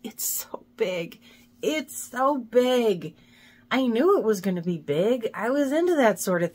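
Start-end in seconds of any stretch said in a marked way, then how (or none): 0.0-1.2 whisper. Real, not acted. Overwhelmed by huge project. 1.6-3.1 slow, stretched "It's so big"; slow drawn out. Real, not acted. Overwhelmed by huge project.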